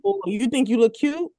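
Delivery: high mocking tease; teasing dad voice. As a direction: needle her like a nosy dad. Dad's teasing quote.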